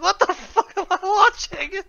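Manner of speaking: high, drawn-out mock disbelief; retold bewilderment. Friend retelling waking up to a strange film, bewildered. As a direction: slowly act out baffled shock, high-pitched.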